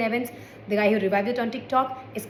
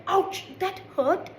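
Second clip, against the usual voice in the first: mock hurt; 8 semitones higher, much slower than usual. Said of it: "I was like".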